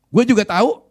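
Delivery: a sudden loud, high snap; harsh retort. Retold or imitated. Rival pastor snapping back at the usher, very loud. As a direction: throw it back rudely. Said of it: "Kasar sekali bicaranya".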